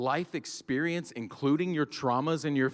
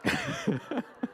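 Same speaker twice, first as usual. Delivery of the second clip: high squeal of laughter. Real, not acted. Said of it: "I guess".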